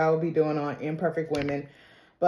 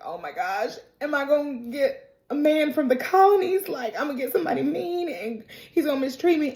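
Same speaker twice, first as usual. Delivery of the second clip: higher-pitched imitation of fretting; mock tearful worry; 7 semitones higher than usual. Girl dreads a mean husband, mock tearful. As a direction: lightly play a girl crying over her future. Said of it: "and she's crying".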